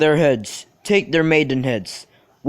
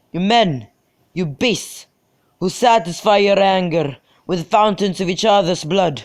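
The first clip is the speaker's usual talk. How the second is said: a raised, commanding ruler's rebuke; stern prince; 4 semitones higher than usual. Acted. The Prince orders the brawling houses to disperse, stern and commanding. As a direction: lay down the law with authority.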